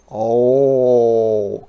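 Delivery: loud goat cry. A narrator's reading. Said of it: "cried Goat".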